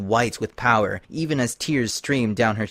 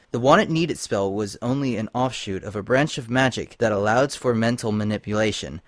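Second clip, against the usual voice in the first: ordinary delivery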